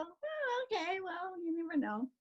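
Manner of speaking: doubtful visitors. Retold or imitated. Visitors doubting her, mock high skeptical voice. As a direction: lightly play the sceptical neighbour. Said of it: "And go".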